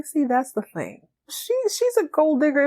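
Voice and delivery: ordinary talk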